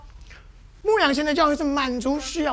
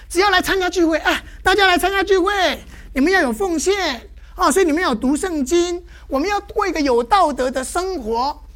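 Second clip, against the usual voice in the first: mock pastor exhorting; 4 semitones higher, 7 dB louder than usual. Mimics pastor exhorting congregation.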